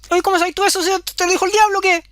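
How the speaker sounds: high, indignant disbelief; the goblin caught out by his own name; shocked goblin. Acted. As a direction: blurt it out high and stunned.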